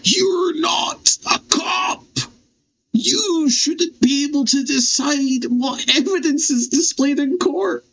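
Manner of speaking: very high, slow, yelled protest; outraged shout. Real, not acted. Player yelling at the witness about evidence, outraged shout. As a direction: yell it at the screen.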